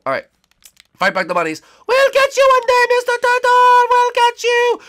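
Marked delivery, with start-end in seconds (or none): 1.9-4.8 very high, loud squealed threat; vengeful squeaky bunny. Acted. Bunnies yelling after Mister Turtle, squeaky and vengeful. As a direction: shriek threats after someone fleeing.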